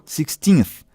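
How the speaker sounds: "sixteenth" ending with the tongue against the upper teeth; teacher models the final th of "sixteenth", tongue on the upper teeth. Shown as a demonstration. Professora mostra o th de "sixteenth" com a língua no dente. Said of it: "a língua no dente superior".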